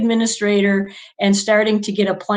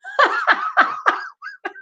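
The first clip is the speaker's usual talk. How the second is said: a big squealing laugh, pitched way up; real laugh. Real, not acted. Laughing at her own story.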